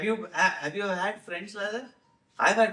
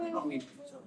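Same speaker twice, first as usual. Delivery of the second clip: cooing whisper; 5 semitones lower, 8 dB quieter, faster than usual. Retold or imitated. Parent coaxing child.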